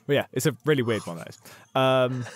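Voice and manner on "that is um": ordinary talk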